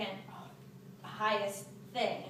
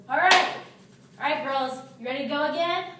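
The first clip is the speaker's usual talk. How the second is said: put-on loud, higher-pitched dad rallying the kids; jokey dad; 5 semitones higher, 10 dB louder than usual. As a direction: boom it out like a dad cheering up the girls. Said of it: "like, joke around. So my dad goes".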